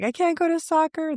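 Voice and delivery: put-on high whiny sob of a crying boy; mock crying kid. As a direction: imitate a tearful kid. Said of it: "he was crying and he's like".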